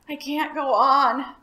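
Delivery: loud, slow, out-of-breath gasp; puffing, exhausted. A narrator's reading. Tired dragon gives up in the storm, out of breath. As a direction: puff it out, spent. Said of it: "puffed the dragon".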